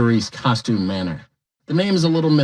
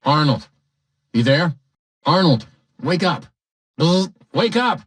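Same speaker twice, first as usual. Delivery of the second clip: radio dispatch voice; 2 semitones higher, 5 dB louder than usual. Acted. Dispatch voice waking Arnold.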